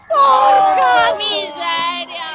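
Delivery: long, loud drawn-out curse; frustrated shout. Real, not acted. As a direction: drag the curse out slowly. Frustrated at a missed chance.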